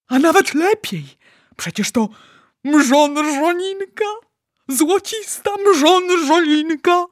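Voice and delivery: high, loud, stretched-out marvelling; thrilled delight. Acted. Giant delighted by his rare catch.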